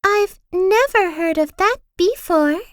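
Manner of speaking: put-on high, cheery girl voice; a curious, sunny lilt up high; bright Little Miss Sunshine. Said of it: "she thought to herself".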